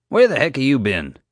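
annoyed demand; irritated demand. Acted. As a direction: boss scolding a latecomer. JJ snaps at Pete.

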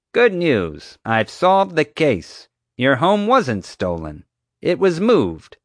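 high, self-satisfied announcement; proud. A narrator's reading. Guard telling the family the case is solved, proud. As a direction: deliver the verdict with a proud grin. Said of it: "grinning proudly".

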